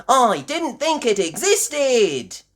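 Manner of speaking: slow, high, loud amazement; astonished. A narrator's reading. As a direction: draw it out in high-pitched disbelief. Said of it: "gasped Miss Rabbit".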